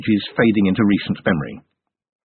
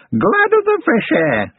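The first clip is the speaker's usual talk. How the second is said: high, slow crone voice, sly; haggling goblin crone; slower than usual. A narrator's reading. Goblin granny naming her price to Carrot, sly high crone. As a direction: bargain like a sly old goblin.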